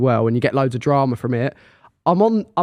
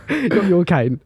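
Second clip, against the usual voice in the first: normal talking voice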